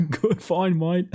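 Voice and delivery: put-on laughing Australian accent, high pitched; giggling through a mock Aussie line. Repeats friend's Aussie line laughing.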